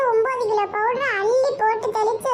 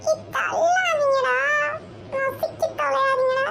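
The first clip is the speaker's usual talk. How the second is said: higher, slow near-crying plea in a crush; a boy on the verge of tears, begging his uncle to save him; panicky crowd whine; 6 semitones higher than usual. Acted. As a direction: whimper like you're being squashed in the crowd.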